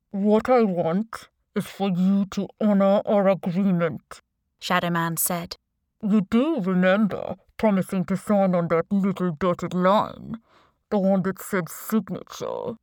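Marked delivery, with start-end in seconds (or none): 0.1-4.2 disguised voice, mock courtesy; anonymous boss on a video call; deep, distorted voice. A narrator's reading. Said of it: "distorted voice". 6.0-12.8 disguised voice, mock courtesy; anonymous boss on a video call; deep, distorted voice. A narrator's reading. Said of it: "distorted voice".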